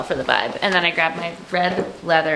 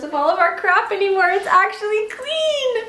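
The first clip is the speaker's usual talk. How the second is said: delighted; 12 semitones higher than usual. Real, not acted. Delighted at clean house.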